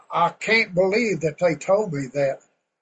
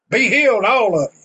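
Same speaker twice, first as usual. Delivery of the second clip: high, loud healing command; preacher's booming decree; commanding shout; 4 semitones higher, 7 dB louder than usual. Acted. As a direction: proclaim it over the whole crowd. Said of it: "he stood out there and he said".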